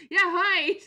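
high, loud retold squeal; excited greeting. Vlogger answering the thrilled booth lady, squealing. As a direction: answer in a big squealed hello. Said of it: "And I was like".

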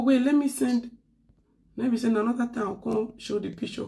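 ordinary talk, nothing marked

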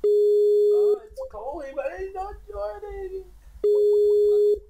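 high, loud whining yell; real frustrated high yell. Real, not acted. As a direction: wail at the dead call. Friend won't rejoin the call.

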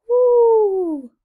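high, loud, long falling hoot; drawn-out "ooh" owl call. Acted. Reader voices owl's hoot.

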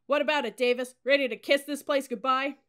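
high, loud goading shout; hectoring drill-sergeant yell; bellowed taunt. A narrator's reading. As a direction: hound the cadet up the stairs. Cadet officer hounds her upstairs.

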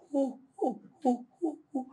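tiny high breathy hoo; mock feeble whispered cheer. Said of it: "We dey whisper".

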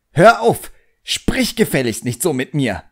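put-on a stamping boy ordering his demon to stop; shrieking command, then indignant scolding; furious boy. A narrator's reading.

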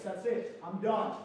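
normal talking voice

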